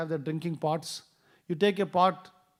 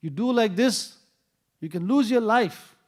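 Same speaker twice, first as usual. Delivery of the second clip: louder, higher stern scolding; chastising; 5 semitones higher, 6 dB louder than usual. Retold or imitated. Subudhi Raya scolding the careless boy, loud and stern. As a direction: scold him sharply for a dangerous mistake. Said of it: "He called him and chastised him".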